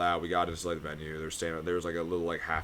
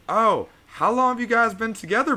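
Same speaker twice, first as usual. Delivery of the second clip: high sweet woman's voice; 9 dB louder than usual. Retold or imitated. Quoting a woman's question.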